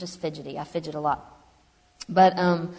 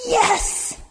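high-pitched imitation of an elated cheer; retold scream, pitched way up without full volume; mock triumphant scream; 12 semitones higher, much slower than usual. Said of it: "he screamed".

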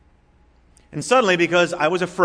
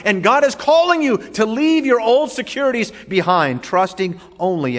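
raised, emphatic sermon delivery; high, insistent pulpit voice; fervent preaching; 7 semitones higher, 6 dB louder than usual. Acted. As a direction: preach it out with mounting force.

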